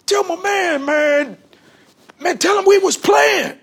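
high, loud pleading shout; panicked rivals begging to call off the shooter; retold frantic plea. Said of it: "they said".

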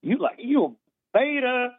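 high, teasing impression of the host's catchphrase; mocking the host. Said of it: "the biggest message, man, that you say is like".

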